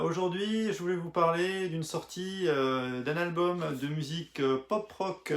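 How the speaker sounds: normal talking voice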